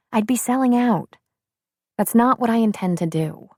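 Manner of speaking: put-on brisk, lively young woman. A narrator's reading. Shelby speaks.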